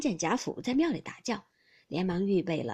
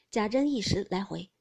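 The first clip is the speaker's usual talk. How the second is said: ordinary delivery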